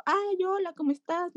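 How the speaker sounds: saludo agudo y dulce a un niño pequeño; saludo cariñoso. Retold or imitated. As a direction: recibe al niño con voz aguda y cariñosa. (saludo agudo y cariñoso a un niño)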